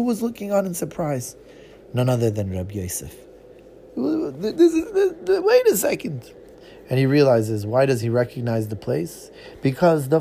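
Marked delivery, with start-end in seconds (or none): 4.0-6.3 high, stunned stammer; shocked. Acted. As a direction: catch the shock. Shocked realization.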